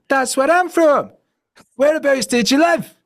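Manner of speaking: very high, loud mock-Scottish woman; hammed-up delighted Scottish girlfriend; imitated Scottish accent. Girlfriend thrilled at a shared hometown, high loud mock-Scottish. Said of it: "And she goes".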